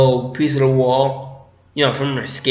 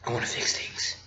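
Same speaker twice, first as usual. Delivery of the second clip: very soft, low acted line; hushed confession; 5 semitones lower, 15 dB quieter than usual. Fan acting out the father-son scene, hushed. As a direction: barely voice it, as if confiding.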